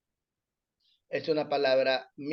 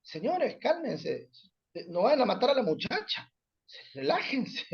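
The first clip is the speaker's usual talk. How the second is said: calming; 6 semitones higher, 5 dB quieter than usual. Retold or imitated. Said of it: "yo dije".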